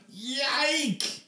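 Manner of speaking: quick high squeal; high yelp. Acted. Emily squeals as she tugs the brush, high.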